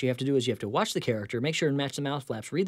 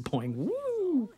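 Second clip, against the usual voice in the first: tiny homunculus voice. Retold or imitated. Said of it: "I see him boing".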